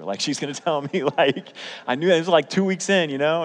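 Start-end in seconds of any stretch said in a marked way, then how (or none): 1.0-3.4 higher and louder, laughing at himself; excited laughing aside. Real, not acted. Preacher laughing about being dumped, excited. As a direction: tell it with a laugh. Laughing at his own fear.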